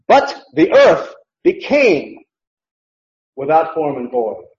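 But the earth became with earth drawn out slowly; slow down and lean on earth; emphasis on earth. Shown as a demonstration. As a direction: earth stretched long and stressed, the rest settles down. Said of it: "So it's emphatic".

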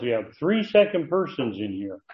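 ordinary talk, nothing marked

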